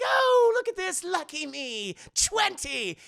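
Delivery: high, loud, sing-song gloat; a villain delighted with his cards; gloating Joker. Retold or imitated. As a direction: crow over the winning hand.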